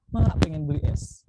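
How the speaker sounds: put-on light childish whine asking for ice; child begging mom. Child asks mother for ice, lightly whiny. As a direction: play a kid pleading with mama.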